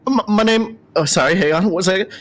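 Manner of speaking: high, stammering owl voice; flustered owl Dorn. Acted. Owl introduces himself to the party, high and flustered. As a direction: fumble your own name nervously.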